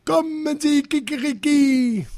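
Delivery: high mock ringmaster crow; imitating the director's crowing. A narrator's reading. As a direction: mimic his call as a rooster's crow. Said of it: "hört es sich an wie".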